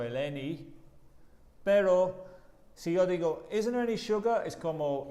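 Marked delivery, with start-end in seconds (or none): 3.5-4.5 "Isn't there any sugar?" higher and louder, surprised; surprised negative question. Shown as a demonstration. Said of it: "tiene que cambiar el tono de voz". (pregunta sorprendida: "Isn't there any sugar?")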